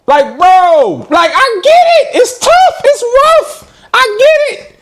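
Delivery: about to cry. Real, not acted. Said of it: "I'm about to cry".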